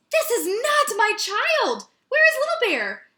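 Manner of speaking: high, loud, slow panicked cry; mother bear crying out for her cub; alarmed cry. A narrator's reading. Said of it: "She cried, choking on a mouthful".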